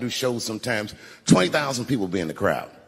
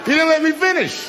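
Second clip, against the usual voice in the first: much higher, loud outburst at the crowd; insistent shout; 7 dB louder than usual. Real, not acted. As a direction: cut off the laughter, shouting. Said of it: "This is not gonna work".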